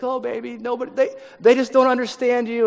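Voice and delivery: doting mom. Retold or imitated. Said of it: "She's like".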